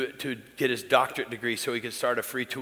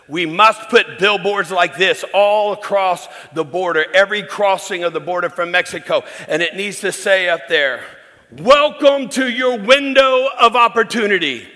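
imitating dad; 7 semitones higher, 11 dB louder than usual. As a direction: proclaim it like a revival preacher. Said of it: "he says".

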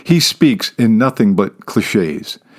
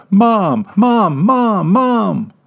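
put-on child shouting for mom; 10 semitones higher, much slower than usual. A narrator's reading. Toddler in his crib shouting for his mother. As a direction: call out like a little boy wanting his mom. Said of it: "and then shout".

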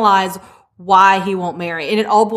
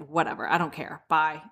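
lower, softer bored brush-off; dismissive mutter; 6 semitones lower, 9 dB quieter than usual. Retold or imitated. Alice dismissing her mother, low and soft. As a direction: toss it away like she's done.

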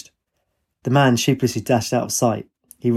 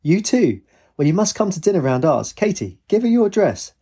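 higher, swooping exclamation; hearty cry; 8 semitones higher than usual. A narrator's reading. Father inviting checkout assistant to dinner, exuberant cry. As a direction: cry it out high with mild gusto. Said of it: "He bellered a laugh and cried".

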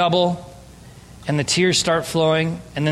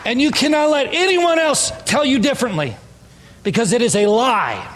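full-force preaching; 6 semitones higher than usual. Acted. Pastor exhorting the congregation, preaching at full force. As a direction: lift the room and drive it home.

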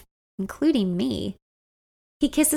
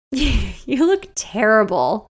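sighing; much slower than usual. Acted. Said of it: "I sigh".

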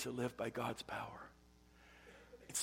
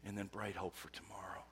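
whispered, much softer; soft hopeful whisper; hushed; 4 semitones lower, 10 dB quieter than usual. Real, not acted. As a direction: breathe it out gently. Sermon, tender whispered close.

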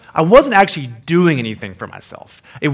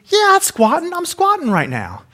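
much higher, defensive squeaky insistence; insistent buddy. Retold or imitated. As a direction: protest like a caught teenager.

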